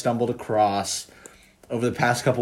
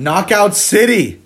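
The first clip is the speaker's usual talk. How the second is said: higher, louder, slowed big reveal; hype announcement; 8 semitones higher, 7 dB louder, slower than usual. Real, not acted. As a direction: announce it like a game show. Announcing the game.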